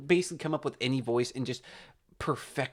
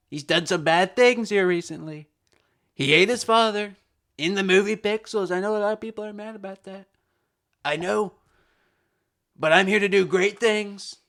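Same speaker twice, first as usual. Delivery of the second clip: higher-pitched, emphatic political rally voice; Trump impression; 3 semitones higher, 3 dB louder than usual. Addressing supporters about bringing back Pac-Man, Trump impression. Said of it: "that's kinda my Trump impression".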